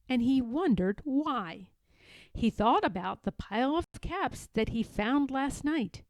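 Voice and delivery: usual speaking voice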